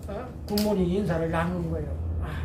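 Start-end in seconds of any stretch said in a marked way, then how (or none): none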